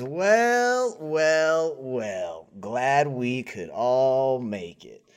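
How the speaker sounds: drawn-out greeting that starts high and steps down; swaggering gang boss. Acted. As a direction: welcome the room slowly, relishing each word. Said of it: "this tan man who says".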